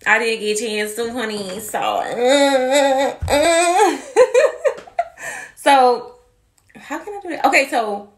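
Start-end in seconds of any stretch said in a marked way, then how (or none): 1.8-5.5 loud singsong laughter. Real, not acted. Laughing at failed plan.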